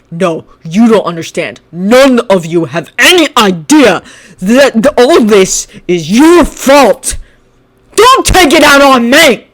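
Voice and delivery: loud, slow furious yell. Acted. Bakugo yelling at Shinso, loud and slow. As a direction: bellow it, blaming them. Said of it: "Bakugo yelled".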